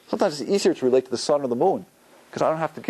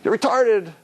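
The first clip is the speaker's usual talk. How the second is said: higher, louder teasing jab; a teen ribbing a friend, higher and louder; mock teen insult; 9 semitones higher, 4 dB louder, slower than usual. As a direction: fling it like a cheeky teen. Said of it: "they use words like this".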